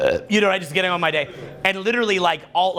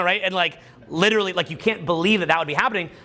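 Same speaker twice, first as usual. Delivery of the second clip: ordinary delivery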